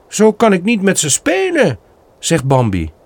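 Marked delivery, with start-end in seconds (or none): 0.1-1.8 put-on much higher, childlike protest; a young deer whining a little; pleading fawn. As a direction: Bambi protests to his father, high childlike voice. Young Bambi protests to father.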